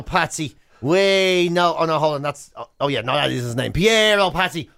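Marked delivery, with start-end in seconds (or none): none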